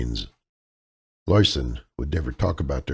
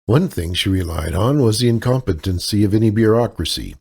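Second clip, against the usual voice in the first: plain reading voice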